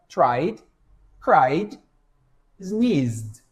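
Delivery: "tried, cried, sneezed" each ending in a voiced D. Shown as a demonstration. Teacher demonstrates voiced ed endings. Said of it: "som de D, ó".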